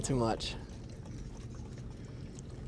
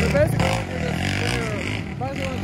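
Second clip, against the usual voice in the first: pitched-down, slowed-down voice effect; slowed deep voice. Acted. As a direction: drop the voice way down like slowed tape. Voice shifted far down.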